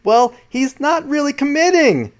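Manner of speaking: high, drawn-up imitation of complaining people; mock critics. Podcaster mimicking people who doubt Lou, high mocking voice. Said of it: "people are saying".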